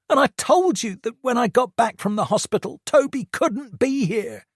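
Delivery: a hint of the aggrieved son; hurt angry teen. A narrator's reading. Josh's voice.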